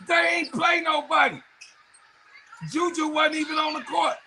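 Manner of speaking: yelling at a bragging fan; shouting. Real, not acted. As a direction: shout the point home. Trash talking rival fan.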